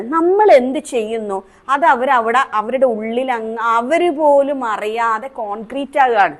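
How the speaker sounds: ordinary talk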